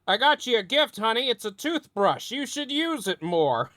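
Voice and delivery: lightly voiced smug gift giver; reality TV guy. Guy from ninety Day Fiancé giving a toothbrush, soft high mock voice. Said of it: "that guy from ninety Day Fiancé".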